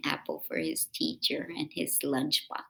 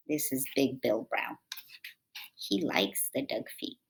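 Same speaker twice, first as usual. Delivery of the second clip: ordinary delivery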